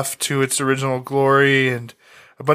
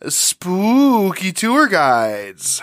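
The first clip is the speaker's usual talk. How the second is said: hammed-up, drawn-out spooky announcement; mock-spooky, slow swooping delivery; spooky ghost-story voice; 4 semitones higher, much slower than usual. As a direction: stretch SPOOKY out long like a campfire ghost tale.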